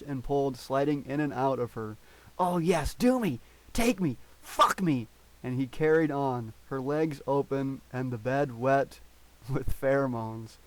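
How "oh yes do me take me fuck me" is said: high, loud pleading cries; passionate cries. A narrator's reading. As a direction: beg for more, urgently.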